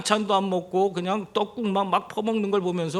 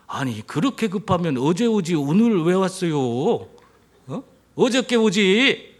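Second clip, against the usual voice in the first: deadpan Chungcheong man scolding a hurried driver; Chungcheong dialect; 4 dB louder than usual. Retold or imitated. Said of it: "이것이 충청도 화법인데".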